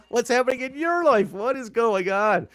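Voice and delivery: high-pitched, laughing incredulity; real amused bewilderment; laughing disbelief. Real, not acted. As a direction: crack up as you ask, pitch way up. Laughing disbelief at co-host's song.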